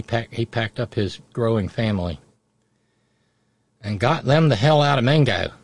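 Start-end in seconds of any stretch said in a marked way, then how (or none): 3.8-5.5 loud, higher West Virginia hill accent; Appalachian hill accent. Shown as a demonstration. Telling of the family leaving Mingo, in Appalachian accent. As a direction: drop into an emphatic Mingo County mountain accent. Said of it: "I drop into the accent for that".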